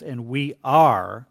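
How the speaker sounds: "are" drawn out slow, rising; slow pressed "are" to show present tense; stress on are. Shown as a demonstration. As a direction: hold and lift "are". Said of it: "you notice how it says".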